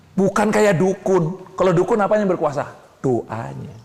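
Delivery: ordinary talk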